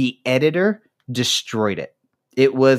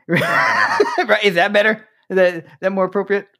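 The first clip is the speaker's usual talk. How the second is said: laughing; 10 semitones higher than usual. Real, not acted. Laughing after the accent.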